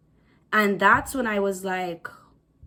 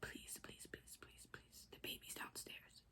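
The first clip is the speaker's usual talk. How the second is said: barely audible whispered plea; desperate begging; 30 dB quieter than usual. Retold or imitated. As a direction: plead in the faintest whisper, reliving it. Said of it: "I begged and I begged".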